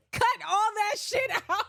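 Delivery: high, loud, through laughter; laughing protest. Real, not acted. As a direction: yell it while cracking up. Laughing protest after own accent bit.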